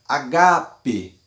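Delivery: agape with a loud, stressed middle GA. Shown as a demonstration. Teacher showing where the accent falls in agape, stress on GA. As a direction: lean on the GA in agape. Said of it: "Agape está no gá, na letra A".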